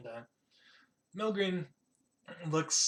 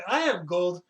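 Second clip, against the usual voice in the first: loud, higher-pitched eager announcement; eager boast; 4 semitones higher, 9 dB louder than usual. Acted. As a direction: blurt it out proudly as a game character. Character eagerly boasts of gold.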